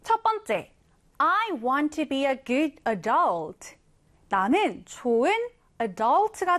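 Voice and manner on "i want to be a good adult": very slow, clear read-aloud; slow example reading. Shown as a demonstration. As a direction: read it word by word for learners. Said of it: "예문을 보면서".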